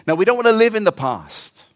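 usual speaking voice

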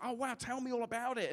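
high, keen retold reply; eager interest. Preacher encourages a stranger on the train, eagerly interested. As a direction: act out being all ears.

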